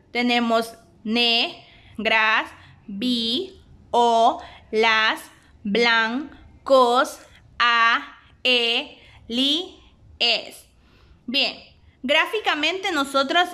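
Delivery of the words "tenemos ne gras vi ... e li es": slow, each syllable split apart. Shown as a demonstration. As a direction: count out every syllable with a small break. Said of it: "la separación utilizando diéresis". (sílaba por sílaba, despacio)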